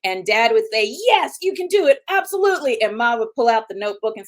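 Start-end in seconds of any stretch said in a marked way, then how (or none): 1.1-2.8 high, louder burst of encouragement; imitating an all-in supportive father, pitched way up; cheerleader dad. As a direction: play a dad cheering the kid on. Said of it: "our dad was our cheerleader".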